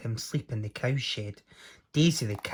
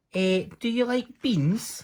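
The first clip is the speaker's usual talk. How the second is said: high, slow teasing offer; sly Jack; 10 semitones higher than usual. Acted. Jack tempting the giant with beans, high and slow. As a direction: dangle the offer slowly.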